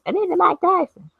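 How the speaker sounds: high, squeaky imitation; Mike Tyson video voice. Vlogger parodying a video she keeps seeing, mock high voice. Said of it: "I need a remix of his voice".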